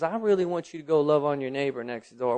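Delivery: ordinary talk, nothing marked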